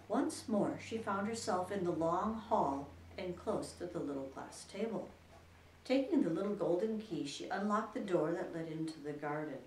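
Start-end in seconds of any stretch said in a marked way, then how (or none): none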